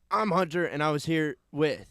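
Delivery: loud, high show sign-off; radio announcer. Acted.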